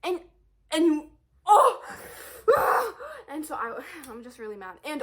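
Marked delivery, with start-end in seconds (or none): 0.0-1.8 high, loud frustrated outburst; exasperated cry. Real, not acted. Venting at mom, loud exasperated cry. 1.9-3.2 high laughing groan of frustration; frustrated sigh-laugh. Real, not acted. After mom's email mixup, a frustrated sigh-laugh.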